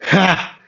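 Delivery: a sharp high scoffing "ha"; mocking ha. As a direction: scoff at them, high and quick. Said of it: "And Gauche would say".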